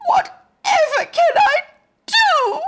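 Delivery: high, loud wail of a worried mother. Acted. Mother despairing over a baby that won't eat, high wail. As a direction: cry out in a high pleading wail. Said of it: "wailed Missus Hargraves".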